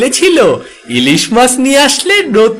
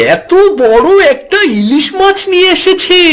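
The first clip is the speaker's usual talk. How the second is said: put-on child's voice; 11 semitones higher than usual. Child tells grandmother excitedly.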